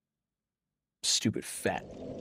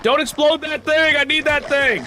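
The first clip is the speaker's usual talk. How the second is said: high, loud desperate protest; frantic yelling; 10 semitones higher, 5 dB louder than usual. Real, not acted. Gamer to enemy about to explode thing, frantic high yell. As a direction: yell to stop them, pitched way up.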